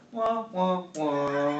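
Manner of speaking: high playful wah wah; 1 mock wah wah. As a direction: whine it like a sad trombone. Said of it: "Burb goes".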